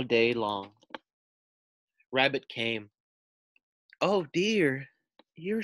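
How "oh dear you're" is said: high, slow soothing voice; tender. Acted. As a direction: comfort a frightened friend. Rabbit comforts shaking Frog.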